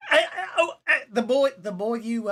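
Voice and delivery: laughing through the words. Real, not acted. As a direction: breaks up laughing mid-line. GM laughing in character.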